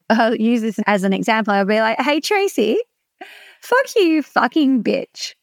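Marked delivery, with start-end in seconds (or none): 2.0-5.3 high, slow, teasing affectionate jab; playful mock insult. Shown as a demonstration. Coach jokingly insults Tracy to the group, playful and slow. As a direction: tease her like a best friend. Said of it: "And everyone will giggle".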